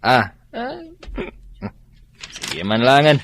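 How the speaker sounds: ordinary talk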